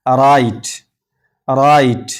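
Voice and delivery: राइट starting straight on the R; silent W. Shown as a demonstration. Teacher models write for learners, राइट with silent W. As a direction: say राइट with no W sound. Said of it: "ये डब्ल्यू खामोश है".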